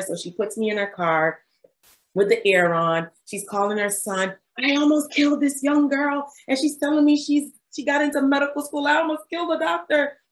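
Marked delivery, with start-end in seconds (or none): 4.6-10.2 mock hysterical lady. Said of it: "she's getting more hysterical".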